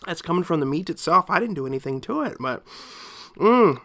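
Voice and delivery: ordinary talk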